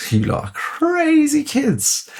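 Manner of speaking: high, slow mock-fussy scolding. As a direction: tut at them like a fussy auntie.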